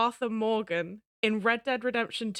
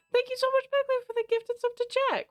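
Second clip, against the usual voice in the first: much higher, chirpy squeak; squeaky thank-you; faster than usual. Pretend. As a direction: squeak out your thanks like a cartoon.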